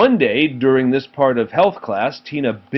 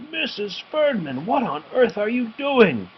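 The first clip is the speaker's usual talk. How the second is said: high, shocked outburst; shocked principal; 10 semitones higher than usual. A narrator's reading. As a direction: catch her in the act. Principal catches teacher.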